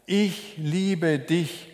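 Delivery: normal talking voice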